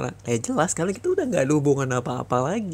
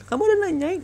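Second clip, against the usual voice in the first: indignant cry; 4 dB louder than usual. Acted. Menuntut mantan dengan marah.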